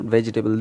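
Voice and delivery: "vegetable" in four syllables, ve-ji-ta-bul; wrong pronunciation. Shown as a demonstration. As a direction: say "vegetable" with the middle vowel sounded. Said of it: "it is not vegetable".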